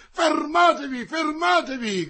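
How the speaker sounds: very high, loud command to halt; urgent shout. A narrator's reading. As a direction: cry out to stop your men. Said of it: "gridò colui che avevano chiamato". (grido acuto e concitato)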